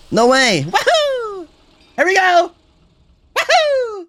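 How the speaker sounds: high-pitched, excited whoops; excited whooping. Real, not acted. Streamer cheers the promise of new content, excited whooping.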